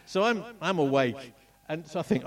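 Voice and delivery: normal talking voice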